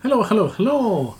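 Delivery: a light put-on Scottish accent on the greeting; hello, hello, hello in a playful Scottish lilt; mock Scottish. Cohost greets the host in a hint of Scottish accent. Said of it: "decided to be Scottish for a minute there".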